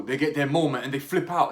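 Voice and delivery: usual speaking voice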